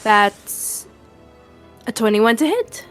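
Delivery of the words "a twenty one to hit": ordinary delivery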